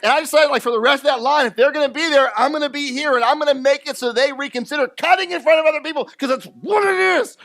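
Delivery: high, loud worked-up rant; fired up. Real, not acted. Pastor telling congregation his revenge, worked-up shout. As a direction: build into a near shout of outrage.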